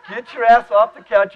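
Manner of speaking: mock bellow to get the kid up; retold dad yell. Dad yells at lazy son to get him to church, mock bellow. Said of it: "He goes".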